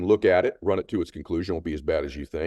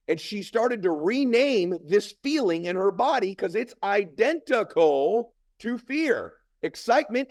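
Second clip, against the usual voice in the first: high, slow, drawn-out emphasis; impassioned motivational speech; 14 semitones higher, slower than usual. Real, not acted. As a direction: preach the point to a crowd.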